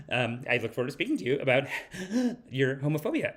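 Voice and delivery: halting, nervous high schooler with timid pauses; Justin Trudeau. Acted. Comedian mimics leader confronting Italy, nervous and halting. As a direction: hesitate and hedge like a nervous teenager. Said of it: "like, a nervous high school".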